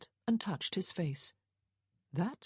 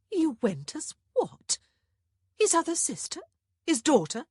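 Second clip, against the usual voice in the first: astonished; 12 semitones higher, 6 dB louder than usual. A narrator's reading. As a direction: gape in disbelief. Sister stunned by revelation.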